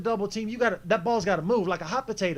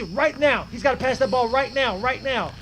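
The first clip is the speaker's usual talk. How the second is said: higher, louder, insistent repetition; urgent insistence; 4 semitones higher, 5 dB louder than usual. Real, not acted. Player reviewing a late pass, urgently insistent. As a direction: push each "right now" with urgency.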